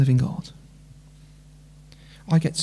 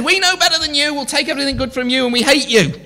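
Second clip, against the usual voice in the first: mocking defiance; 9 semitones higher, faster than usual. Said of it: "they've said".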